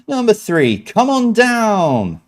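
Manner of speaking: high, very slow drawn-out host call; game show host catchphrase. Retold or imitated. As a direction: stretch it out like a TV host calling a contestant up. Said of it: "That was the regular catchphrase".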